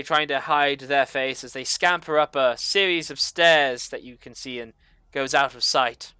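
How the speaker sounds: ordinary talk, nothing marked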